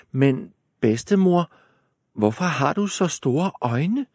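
put-on high puzzled girl. A narrator's reading.

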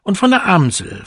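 eager cuckoo. A narrator's reading. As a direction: cuckoo rushing on to the next bird. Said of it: "fuhr er fort".

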